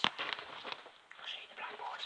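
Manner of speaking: soft, unvoiced whisper; hushed real aside. Real, not acted. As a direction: whisper slowly to the listeners. Reader's hushed aside to listeners.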